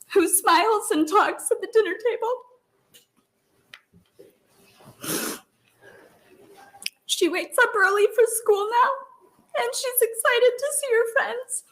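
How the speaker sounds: a mother's real emotion breaking through; tearful. Real, not acted. Mother moved telling of daughter.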